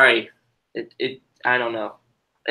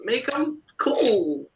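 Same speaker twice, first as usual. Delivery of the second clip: much higher, squeaky mock voice, dragging out "make him cool"; mock Disney exec; 11 semitones higher, slower than usual. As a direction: parody the studio bosses in a silly high voice. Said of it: "they probably would be like".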